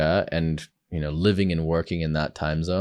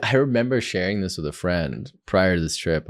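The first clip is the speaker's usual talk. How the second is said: usual speaking voice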